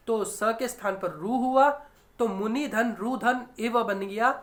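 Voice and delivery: normal talking voice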